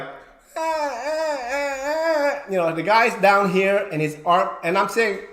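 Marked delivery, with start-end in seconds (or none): 0.0-2.4 high, strained fake groans; mock pinned groans. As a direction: ham up a wrestler's fake pain. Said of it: "the bottom is like".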